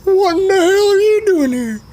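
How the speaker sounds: shrill, very high cranky character; a high squeaky voice demanding answers; Sugar Tits voice. Acted. Sugar Tits challenging Old Skipper's arrival, very high squeak. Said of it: "Sugar Tits is sitting there".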